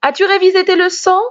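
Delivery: teacher showing a question tune; question intonation. Shown as a demonstration. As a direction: ask As-tu révisé tes leçons? with a lifted tune. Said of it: "quand je veux poser une question, je dis".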